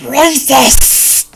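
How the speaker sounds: high, loud, drawn-out hissing screech; witch creature's screech. Acted. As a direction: screech it like an accusing monster. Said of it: "the thing just goes".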